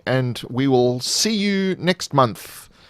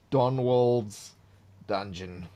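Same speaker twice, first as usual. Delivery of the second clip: soft, musing repeat of the title; quiet repeat; 6 dB quieter, slower than usual. Acted. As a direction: say it again under your breath.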